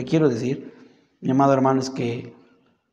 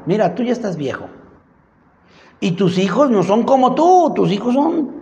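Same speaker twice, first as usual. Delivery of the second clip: accusing townspeople; 7 semitones higher than usual. Acted. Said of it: "le dijeron".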